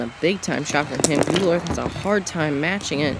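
ordinary talk

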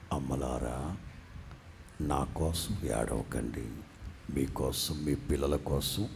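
deep soft voice of Jesus; Jesus speaking tenderly. Acted. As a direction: comfort the weeping women gently. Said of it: "ఏం చెప్పావు".

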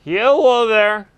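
long stretched hello call; slow drawn-out call. Real, not acted. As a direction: draw the call out slowly. Calling out at a house.

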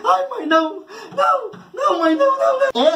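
high, begging cries of no; pleading child. Retold or imitated. Child at the corner begging mom not to hit him, high pleading. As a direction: beg like a scared child.

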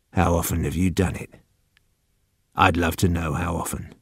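low, very soft gentle prompting; coaxing. Acted. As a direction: coax out a secret, softly. Old man gently coaxes confession.

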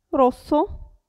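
rosso with a sharp voiceless double s; hard double s. Shown as a demonstration. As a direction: hold a crisp hissing s in rosso. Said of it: "se abbiamo doppia S". (rosso con la doppia s sorda)

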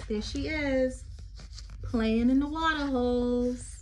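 sing-song read-aloud poem voice. Acted. Teacher reading a poem to children, lilting.

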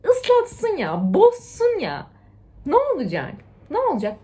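high, loud, slow carefree call; carefree cheer. Retold or imitated. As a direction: toss it off joyfully, high. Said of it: "sonra diyorum ki".

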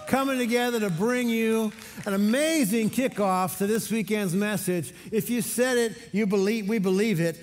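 loud, high, pumped-up church emcee; hype announcer. Real, not acted. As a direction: fire the congregation up after worship. Pastor hypes the worship team.

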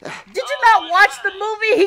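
ordinary talk, nothing marked

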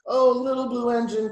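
high, loud appeal; crying out. A narrator's reading. Dolls to little engine, crying out. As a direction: call out to the engine imploringly. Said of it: "cried the dolls and toys".

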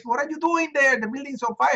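high, frantic mother on the phone; shrill it out like a panicked mom; mother screaming down the phone at her son. Retold or imitated. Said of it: "She just went crazy".

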